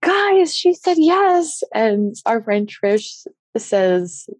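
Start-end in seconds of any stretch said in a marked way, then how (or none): 0.0-1.6 high-pitched retold happy shout. Fiancé announcing to the friends' van, shouting out. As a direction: call it out to friends at a distance. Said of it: "he shouts out to our friend's van".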